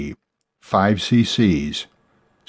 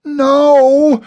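very high, loud, long shriek; desperate scream; 7 dB louder than usual. A narrator's reading. Woman clawing at the man to pull him back, screaming. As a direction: wail it at full pitch. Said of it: "she screamed again".

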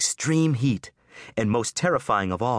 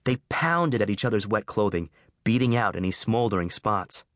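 usual speaking voice

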